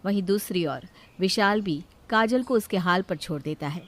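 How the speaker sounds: ordinary delivery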